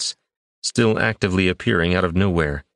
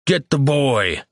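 higher urgent command; shouted order; 7 semitones higher, slower than usual. A narrator's reading. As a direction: bark the order mid-fight. Fight breaks out, shouted order.

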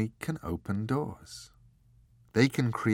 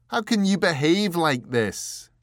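raised, louder, angry demand; 9 semitones higher, 7 dB louder than usual. Acted. Richard angrily confronting the angel, higher and louder. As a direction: confront the angel in pained anger.

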